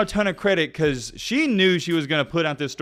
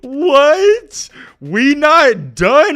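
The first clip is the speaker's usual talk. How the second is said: mock incredulous boyfriend; 11 semitones higher, 9 dB louder, much slower than usual. Reading his threatening text.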